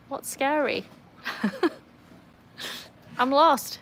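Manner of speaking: ordinary talk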